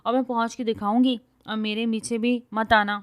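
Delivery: loud defiant dismissal; angry go-away shout; shouting after him. Acted. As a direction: yell at his back as he goes. She shouts after him leaving.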